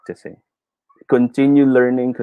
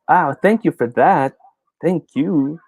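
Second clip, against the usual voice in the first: drawn-out, higher mock gratitude; sarcastic thanks; 5 semitones higher, slower than usual. Corrected speaker thanking whoever corrected them, sarcastic. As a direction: thank them slowly with put-on sweetness. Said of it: "Yung medyo may sarcasm ang dating".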